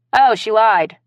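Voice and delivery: offhand, breezy and loud; glib. Acted. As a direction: toss it off lightly. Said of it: "Blaze said glibly".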